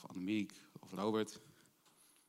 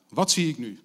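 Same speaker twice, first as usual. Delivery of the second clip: crying out; 3 semitones higher, 10 dB louder than usual. Acted. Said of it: "riep hij".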